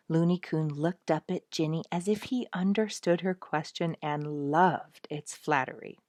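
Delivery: ordinary delivery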